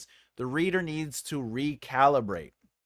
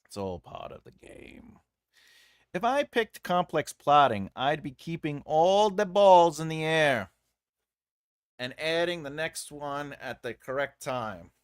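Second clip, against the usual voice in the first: ordinary talk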